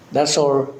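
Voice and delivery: normal talking voice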